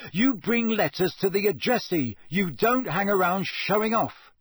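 high, emphatic telling-off; cross scolding. A narrator's reading. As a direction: scold a misbehaving pet, punching the words. Ron scolds his owl.

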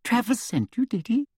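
sugary high-pitched official; sweet, simpering and high; Umbridge voice. A narrator's reading.

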